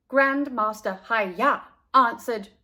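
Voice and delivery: ordinary delivery, nothing marked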